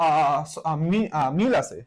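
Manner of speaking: usual speaking voice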